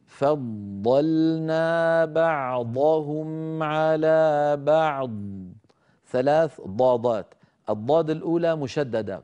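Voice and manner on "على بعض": على بعض slow, ض held heavy and emphatic at the end; Quranic tajweed recitation. Shown as a demonstration. Teacher demonstrating على بعض to students in slow tajweed recitation. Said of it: "فننطقها بهذا الشكل".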